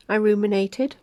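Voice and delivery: ordinary delivery, nothing marked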